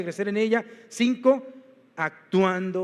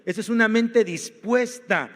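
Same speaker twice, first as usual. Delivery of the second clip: usual speaking voice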